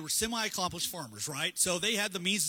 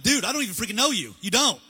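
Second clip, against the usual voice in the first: louder, mock-exasperated dude; exasperated loud retort; 3 semitones higher, 8 dB louder than usual. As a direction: scold him like a fed-up friend. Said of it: "He's like".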